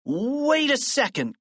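high, slow protest; kid's startled high-pitched objection; crying out. A narrator's reading. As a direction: object in alarm, stretching it out. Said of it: "cried George".

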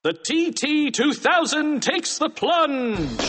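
high, slow, drawn-out announcer call; action announcer. Acted. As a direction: stretch out the villain's fall like a fight announcer. Announces comic fight scenes.

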